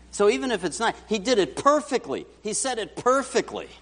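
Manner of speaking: ordinary talk, nothing marked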